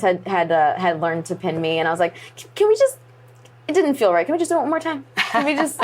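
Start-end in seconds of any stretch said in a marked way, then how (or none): 2.4-5.8 very high, eager begging; eager pleading. Retold or imitated. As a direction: beg to do it once more. Begging to redo the pin.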